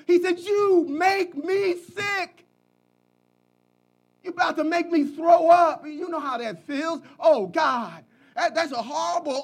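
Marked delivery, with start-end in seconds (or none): 0.4-5.9 slow, high, shouted disgust. Acted. Preacher voicing the Lord's disgust.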